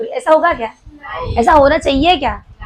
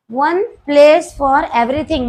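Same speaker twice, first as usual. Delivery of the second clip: very slow, word by word, for notes; slow dictation; much slower than usual. Shown as a demonstration. Teacher dictating to the class, slow word by word. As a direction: spell it out slowly so the class can write. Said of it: "teacher dictating the principle".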